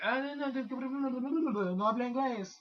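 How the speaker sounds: high mock-Mexican flustered babble; Mexican accent. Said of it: "broke out of the Mexican".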